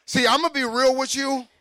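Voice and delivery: high, loud preaching build; preaching shout. Real, not acted. Preacher getting real with the church, loud and high.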